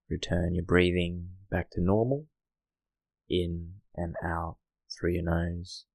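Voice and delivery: hushed guiding voice for settling in; guided meditation. Acted. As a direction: ease the listener into stillness, gently.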